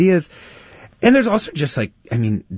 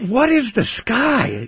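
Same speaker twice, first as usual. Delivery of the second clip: slow, much higher, marvelling; wondering; 13 semitones higher, slower than usual. Real, not acted. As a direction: savour the question slowly. Marvelling at child's question.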